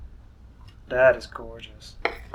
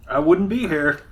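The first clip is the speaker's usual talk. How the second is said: excited; 4 semitones higher, 8 dB louder than usual. Real, not acted. Mom joke banter, laughing.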